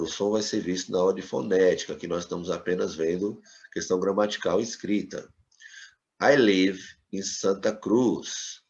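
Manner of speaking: ordinary talk, nothing marked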